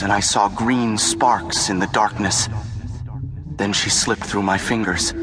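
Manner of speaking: usual speaking voice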